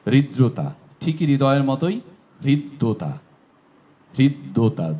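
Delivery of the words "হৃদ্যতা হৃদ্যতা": হৃদ্যতা with the দ said twice, ridd-dota; doubled d. Shown as a demonstration. Teacher showing students the doubled দ in হৃদ্যতা. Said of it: "দ দুইবার হবে".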